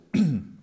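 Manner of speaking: sharp forceful cough; loud throat clearing. Real, not acted. Teacher coughs to get his voice back, forceful cough.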